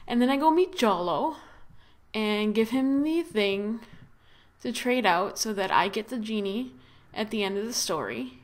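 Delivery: normal talking voice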